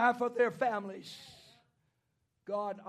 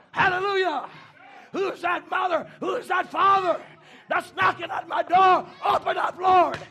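high, loud shouted plea; full-force preaching; 8 semitones higher, 11 dB louder than usual. Acted. Preacher praying as a parent knocking at God's door, full-force preaching. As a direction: cry out like a preacher storming heaven.

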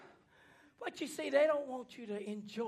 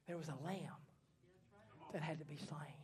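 low, soft, solemn opening; solemn hush; 7 semitones lower, 13 dB quieter than usual. Acted. Preacher begins the story of the slain lamb, solemn hush.

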